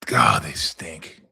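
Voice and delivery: slow whispered disgust. Real, not acted. Fan whispering disgust about the Giants. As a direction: breathe it out slow, fed up.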